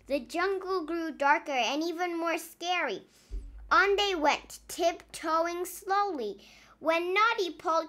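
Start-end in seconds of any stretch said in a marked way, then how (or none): none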